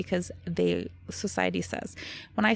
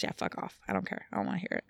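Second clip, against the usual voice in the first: muttered defiance; 7 dB quieter, much faster than usual. Real, not acted. Defiant quiet to imagined critics.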